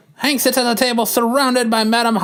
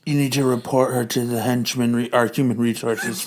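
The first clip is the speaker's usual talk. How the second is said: a sly villain queen giving orders; Madam Hydra voice; 10 semitones lower, 4 dB quieter than usual. Acted.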